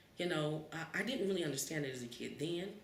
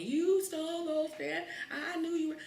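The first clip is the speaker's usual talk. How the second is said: high, loud, slow accusation; accusing shout; 14 semitones higher, 7 dB louder than usual. Retold or imitated. Mother accusing the kid in the car, loud. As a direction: hammer the blame home.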